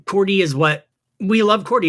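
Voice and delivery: normal talking voice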